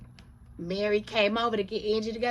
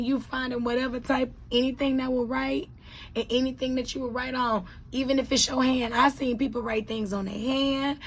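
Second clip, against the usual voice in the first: excited rant; 6 semitones higher, 6 dB louder than usual. Real, not acted. Excited rant about taking notes.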